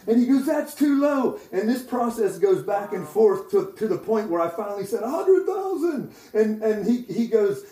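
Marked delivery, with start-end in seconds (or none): 0.5-1.4 insistent prophet. Retold or imitated. Prophet rejects the guess again. 5.0-6.1 very high, strained-out mock cry; desperate guess. Overwhelmed man finally answering the prophet, acted desperation.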